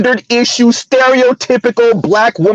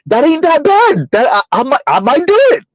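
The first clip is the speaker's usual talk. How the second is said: higher-pitched imitation of brothers talking themselves into it; mock won-over suitor; 6 semitones higher than usual. Host imitates brothers considering single mothers, mock tempted. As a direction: voice a mock rising interest. Voices brothers warming to the idea.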